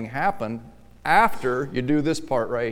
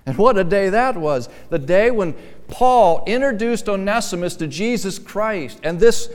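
raised, ringing preacher's voice, climbing higher; preaching at full force; 7 semitones higher, 7 dB louder than usual. Acted. Preacher telling the congregation of a sinner's conversion, exultant and loud. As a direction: proclaim it to the whole church, rising with joy.

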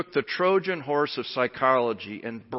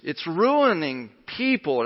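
usual speaking voice

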